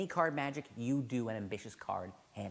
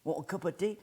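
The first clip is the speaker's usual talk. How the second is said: put-on Derek Dingle British accent; 5 dB louder than usual. Teacher imitates Derek Dingle greeting him in a British accent. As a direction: greet them in a chirpy British accent, offering tea. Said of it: "excuse my British accent".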